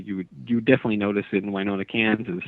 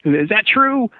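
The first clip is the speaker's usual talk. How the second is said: higher, louder mock Southern question; Southern caller asking, pitched up; Southern accent; 10 semitones higher, 5 dB louder, slower than usual. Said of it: "this guy with a Southern accent".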